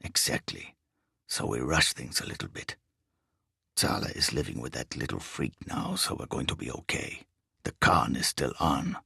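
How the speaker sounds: low, quiet, bass-heavy foreign thief; deep foreign voice. A narrator's reading. Riso answers his partner's questions in a low bass voice.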